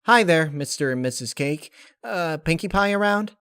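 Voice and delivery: bright shop-door greeting in character; calling a hello into the bakery; cheery Rainbow Dash. Acted.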